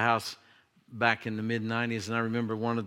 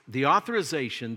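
normal talking voice

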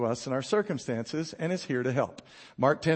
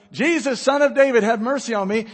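raised, calling voice, higher and louder; crying out to Jesus; 5 semitones higher, 6 dB louder than usual. Acted. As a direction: call out to be heard over the crowd. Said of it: "He cried out all the more".

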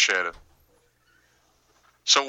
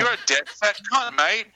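raised-pitch comic Aussie accent; Australian accent; 6 semitones higher than usual. Shown as a demonstration. Podcaster giving another example for cohosts, Australian accent. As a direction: do a matey Australian insult in accent. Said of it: "say it with an Australian accent".

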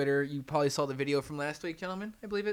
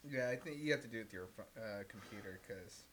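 trailing off; 7 semitones lower, 17 dB quieter than usual. Real, not acted. Talking while checking phone.

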